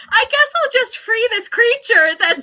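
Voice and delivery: high, loud, laughing through a mock teenage boy line; mock teenage boy voice, cracking up; laughing impression. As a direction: giggle out the mock Wintrow line.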